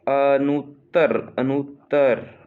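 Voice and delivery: "अनुत्तर" slow and loud in parts; emphatic slow dictation. Shown as a demonstration. Teacher dictating a spelling, अनुत्तर slowly and loudly. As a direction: sound out अ, nut, तर in "अनुत्तर".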